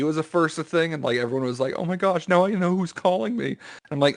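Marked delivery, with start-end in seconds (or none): 1.8-3.6 higher-pitched imitated excitement; mock amazement. Mimicking everyone's first reaction to caller ID, mock amazement. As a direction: marvel like a proud new owner.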